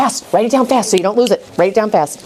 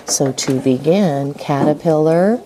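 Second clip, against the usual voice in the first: slow, spelled-out pace; 3 semitones lower, slower than usual. Shown as a demonstration. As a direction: draw it out word by word. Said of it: "say it as you write".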